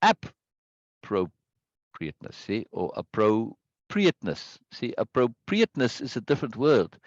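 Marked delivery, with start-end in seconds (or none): 0.0-1.3 "appropriateness" broken up, with a strong AP; AP... pro... priateness; misplaced first-syllable stress. Shown as a demonstration. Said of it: "as opposed to". 3.0-6.0 "appropriateness" with the weight on PRI, slowed; appro-PRI-ateness; third-syllable stress. Shown as a demonstration. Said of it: "start to emphasize the third syllable".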